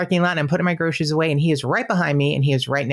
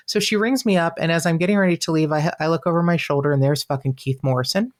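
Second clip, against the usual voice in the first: normal talking voice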